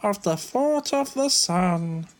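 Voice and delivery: very high put-on character voice; Lloyd voice. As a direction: pitch it way up, almost a squeak.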